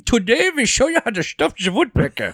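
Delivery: very high, loud mock professor voice; hammed-up Professor Dingle Dong; cartoon professor. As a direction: lecture like a daffy cartoon scientist. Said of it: "That's the professor".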